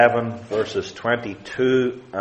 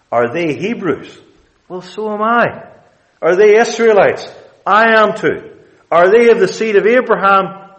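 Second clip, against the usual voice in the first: rising fervour; 8 semitones higher, 5 dB louder than usual. Acted. Preacher builds Paul's rhetorical questions.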